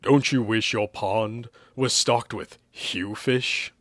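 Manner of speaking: slow commercial announcer voice; drawn-out promotional voiceover; advert voiceover. Acted. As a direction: pitch it slowly like a TV ad. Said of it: "A voiceover asked".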